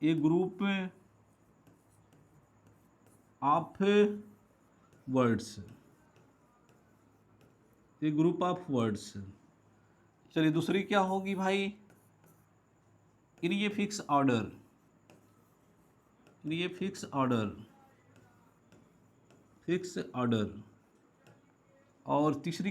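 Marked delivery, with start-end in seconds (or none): 0.0-9.1 word by word with long pauses; slow dictation. Real, not acted. Teacher writing out the first condition, slow dictation. 13.4-20.5 slow, falling, spaced-out phrases; slow dictation. Real, not acted. Teacher writing out the second condition, slow dictation.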